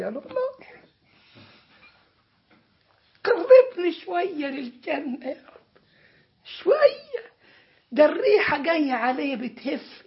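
high, tearful, giddy begging; ecstatic pleading. Acted. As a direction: beg like a man smelling paradise, overjoyed. Servant begs God near paradise.

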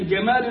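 جمال with a hard Egyptian g, gamal; teaching the Egyptian ج. Shown as a demonstration. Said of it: "الجيم المصرية".

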